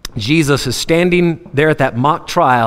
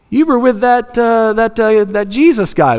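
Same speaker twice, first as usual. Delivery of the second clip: put-on little girl; 7 semitones higher than usual. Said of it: "here comes a little girl".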